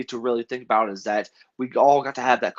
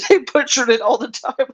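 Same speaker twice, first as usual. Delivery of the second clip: high, louder voice full of amusement; laughing; 12 semitones higher, 4 dB louder than usual. Real, not acted. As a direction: say it through laughter, pitch jumping up. Both laughing at botched announcements.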